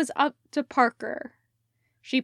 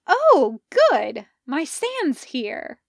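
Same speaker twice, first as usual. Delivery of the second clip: delighted; 4 semitones higher, 7 dB louder, slower than usual. Acted. Parker delighted at delivery.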